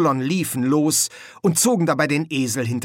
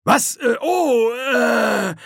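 high, loud, flustered stammer; panicked stalling; 12 semitones higher, 5 dB louder, much slower than usual. A narrator's reading. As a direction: flounder for an answer in terror. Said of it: "Kolon zögerte und wartete auf den stählernen Tod".

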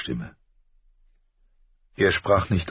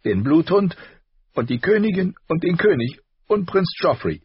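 put-on much higher, louder, indignant girl; tearful rage; 13 semitones higher, 5 dB louder than usual. A narrator's reading. Arya raging at the royals to her father, high and loud. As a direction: rant through tears. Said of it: "rot im Gesicht, schniefend".

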